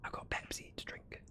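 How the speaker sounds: unvoiced murmured aside; whisper. Real, not acted. Streamer whispering a side remark to chat. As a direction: whisper it like a secret confession.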